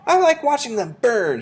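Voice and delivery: put-on high, loud nasty-boy voice; gloating boy. A narrator's reading. Bruno enjoys roasting the ants, high bratty voice.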